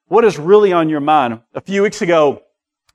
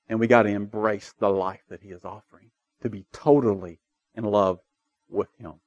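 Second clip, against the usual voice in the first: quiet intensity; 7 semitones lower, 6 dB quieter than usual. Real, not acted. Sermon closing softly.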